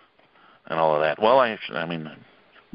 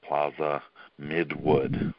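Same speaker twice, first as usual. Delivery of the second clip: slow, word-by-word Plaza Midwood; slowly; 2 semitones lower, 6 dB quieter, much slower than usual. Shown as a demonstration. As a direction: spell out the name slowly and softly, pausing between words. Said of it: "I'll say that again slowly".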